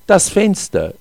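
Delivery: mildly alarmed, high-pitched exclamation; horrified cry. A narrator's reading. Narrator cries out as the bird heads for the window, mildly horrified. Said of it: "rief ich entsetzt".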